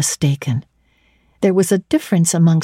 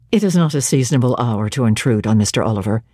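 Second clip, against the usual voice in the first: lower, flat-paced clergyman's refusal, a little quick; stiff refusal; 7 semitones lower, faster than usual. A narrator's reading. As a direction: hold her off, stiff and mechanical. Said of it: "spoke almost like an automaton".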